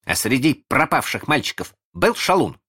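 put-on high, eager boy voice; excited child's question; crying out. A narrator's reading. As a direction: ask it urgently, hoping for yes. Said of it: "закричал Шалун".

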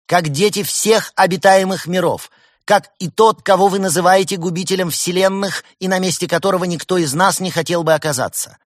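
high, loud, measured proclamation; choral declaration. A narrator's reading. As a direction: declaim it as a group manifesto in unison. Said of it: "и хором заговорили".

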